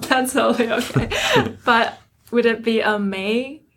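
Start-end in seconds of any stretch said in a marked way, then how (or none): 1.1-1.6 a short, high burst of real laughter; light laugh. Real, not acted. Interviewer laughs at the guest forgetting his own paper title, light laugh. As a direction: laugh it off kindly.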